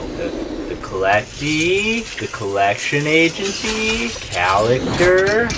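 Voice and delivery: slow, drawn-out mock title reading; mock movie titles. Mocking the sequel names with slow drawn-out titles. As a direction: stretch each fake title like a trailer announcer.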